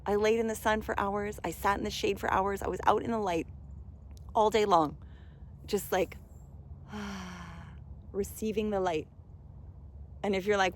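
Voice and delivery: ordinary talk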